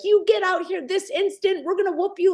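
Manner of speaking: high-pitched scolding, imitating furious parents; mock angry parents. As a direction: play an outraged parent telling kids off. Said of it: "screaming. They were so mad".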